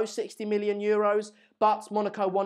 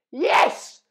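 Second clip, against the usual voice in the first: ecstatic yes; 6 semitones higher, 6 dB louder than usual. Real, not acted. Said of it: "I'm bloody ecstatic".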